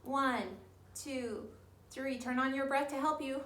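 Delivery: ordinary talk, nothing marked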